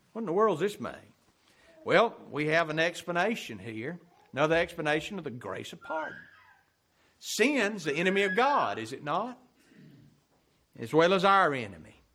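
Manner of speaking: loud, high, rousing pulpit cry; full-voiced revival preaching; fiery preaching. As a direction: preach it out to stir the congregation. Preacher builds to climax.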